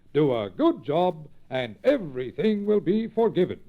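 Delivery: lightly voiced stern but kind boss; a hint of the engine master giving a second chance. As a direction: engine master to Chug Chug, lightly gruff and kindly. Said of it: "and he said".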